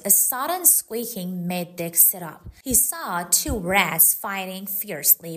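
usual speaking voice

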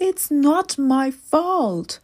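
little man pleading innocence; little man protesting to the big man; defensive little man. A narrator's reading. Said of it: "said the little man".

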